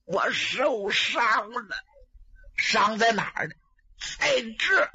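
faint, pained whimper rising high; weak injured voice. Acted. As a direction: barely get the words out through the pain. Wounded man answers where he's hurt.

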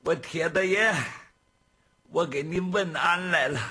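loud, high, fawning plea on his knees; groveling servant. Acted. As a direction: beg the boss for mercy, kneeling. 来福腿一软就跪下了.

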